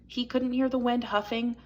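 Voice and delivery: ordinary delivery, nothing marked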